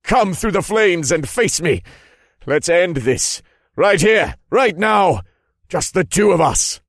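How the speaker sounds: high, loud, furious general; shouted challenge. Acted. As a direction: yell a dare across the battlefield. Enemy general challenges the witch.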